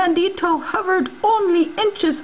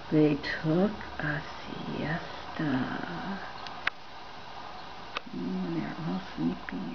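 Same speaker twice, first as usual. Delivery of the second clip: sleepy quiet; 10 semitones lower, 13 dB quieter than usual. Acted. Siesta, sleepy.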